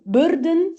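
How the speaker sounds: burden with the first syllable BUR stressed. Shown as a demonstration. For pupils learning stress, burden said with the weight on BUR. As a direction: lean on the start of burden, then let den fall away. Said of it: "stressed on the first one".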